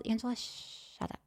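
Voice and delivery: soft hushed scold; whispered self-scolding. Retold or imitated. Telling herself to keep quiet, whispered. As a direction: hush yourself.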